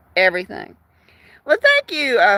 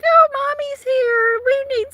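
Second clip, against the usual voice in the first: happy kitten voice; 13 semitones higher than usual. Retold or imitated. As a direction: coo it like kittens glad Mommy's back. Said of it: "they were like".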